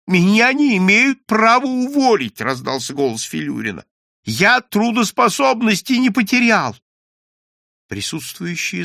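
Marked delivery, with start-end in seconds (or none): 0.1-2.4 loud, high outcry; outraged declaration to a crowd; indignant protest. A narrator's reading. Character protests his dismissal publicly. 4.2-6.7 loud, high outcry; outraged declaration to a crowd; indignant protest. A narrator's reading. Character protests his dismissal publicly.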